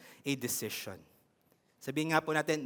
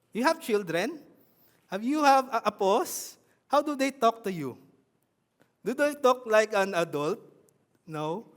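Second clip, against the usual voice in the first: usual speaking voice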